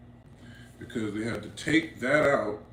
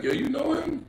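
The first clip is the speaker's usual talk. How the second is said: much higher, disbelieving ask; incredulous question; 13 semitones higher than usual. Retold or imitated. Asking his little homie about the stranger, high incredulous. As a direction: ask like this makes no sense.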